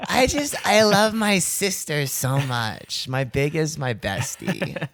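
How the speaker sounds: put-on high, slow sorority girl voice; sorority girl vocal fry. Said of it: "a sorority girl with vocal fry".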